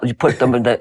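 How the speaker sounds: ordinary delivery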